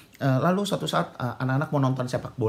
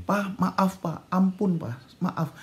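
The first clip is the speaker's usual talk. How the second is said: mildly pleading, higher-pitched apology; pleading child; 6 semitones higher, much slower than usual. Retold or imitated. Son apologising to his angry father, pleading. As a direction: beg Dad lightly for forgiveness. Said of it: "anak ini bilang begini".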